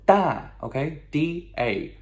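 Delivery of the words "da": DA said high and loud, falling sharply; descending fourth tone. Shown as a demonstration. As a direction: drop the pitch sharply on "Da", like a firm no. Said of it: "Fourth tone, descending tone".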